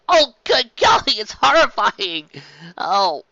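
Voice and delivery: plain reading voice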